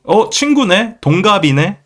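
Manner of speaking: high, bright Korean exclamation; delighted Korean greeting. Retold or imitated. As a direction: say it like discovering a new friend. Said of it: "they say the word 친구".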